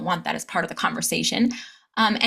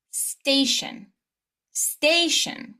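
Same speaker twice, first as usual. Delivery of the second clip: "Station" with STA high and long; primary stress; 5 semitones higher, 3 dB quieter, much slower than usual. Shown as a demonstration. Teacher modelling for repetition, stress before the suffix. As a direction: lift and stretch the first syllable of "Station". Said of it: "stress the syllable right before the shin".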